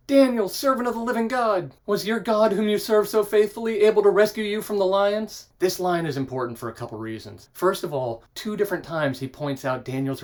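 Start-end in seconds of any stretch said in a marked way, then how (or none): 0.1-5.5 higher, louder anguished call into the den; a mild acted anguish, raised voice; anguished cry. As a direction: call out desperately to Daniel. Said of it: "he cried out in anguish".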